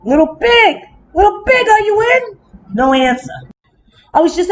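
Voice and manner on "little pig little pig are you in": sing-song call that climbs higher and ends in a shout; wolf calling the pig, rising to a shout. Acted. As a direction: call through the door to the little pig. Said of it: "So I called".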